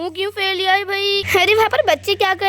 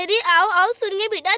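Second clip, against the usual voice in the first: ordinary delivery, nothing marked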